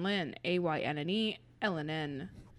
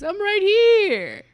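very high, loud and slow alien voice; alien God; 14 semitones higher, 11 dB louder, slower than usual. Acted. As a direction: stretch it out high and booming like a goofy alien.

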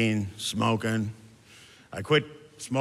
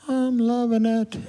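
high, slow, loud slogan from a revived man; waking patient; 12 semitones higher, 6 dB louder, slower than usual. Acted. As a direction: sing out the slogan as he wakes.

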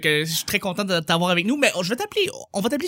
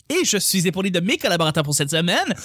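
ordinary delivery

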